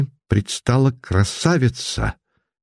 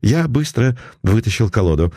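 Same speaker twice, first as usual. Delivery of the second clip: usual speaking voice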